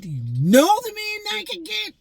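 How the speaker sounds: high, loud, outraged squeal; indignant shout. Real, not acted. Ranting woman shrieks at shallow men, outraged. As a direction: shriek it in disbelief at the insult. Scolding rant, boasting indignantly.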